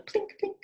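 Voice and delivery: high, light plinking; rain plinks. Acted. Narrator imitating rain on the window, high and light. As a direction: tap the words like raindrops.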